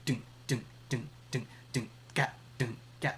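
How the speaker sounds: soft vocal kick and snare hits; beatbox drum pattern. Shown as a demonstration. Teacher beatboxes a dance beat for the class, soft vocal drums. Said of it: "more for electronic dance music".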